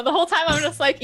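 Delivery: normal talking voice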